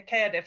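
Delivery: Cardiff as "Kairdiff", spelt K-A-I-R; local Cardiff pronunciation. Shown as a demonstration. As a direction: say Cardiff with a "care" first syllable. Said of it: "spelt with a K A I R".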